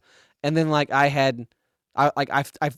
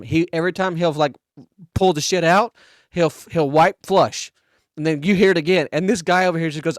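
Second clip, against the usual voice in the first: ordinary delivery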